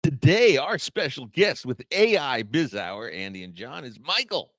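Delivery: booming announcer voice, mocking; big radio host intro. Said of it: "You're making fun of me now".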